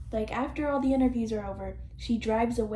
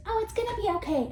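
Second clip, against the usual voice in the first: higher, quick, sing-song mock Barbie; 9 semitones higher, much faster than usual. Vlogger mocking Barbie's fix-all line, high and fast. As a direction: breeze through it like a chirpy doll. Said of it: "all she does is she goes".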